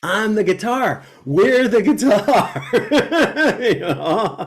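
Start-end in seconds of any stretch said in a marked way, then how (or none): 1.2-4.5 laughing through the words, louder. Real, not acted. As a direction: giggle it out while saying it. Joking guitar metaphor, laughing.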